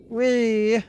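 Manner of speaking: very high playful squeal; gleeful. Real, not acted. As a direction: squeal like on a slide. Riding a platform.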